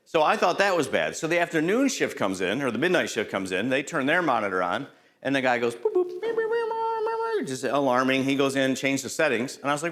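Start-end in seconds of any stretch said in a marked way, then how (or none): 5.7-7.5 high, steady mock alarm beeping; alarm imitation. Trainer mimics the monitor alarming nonstop, high mock beeping. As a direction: sing out a detector alarm.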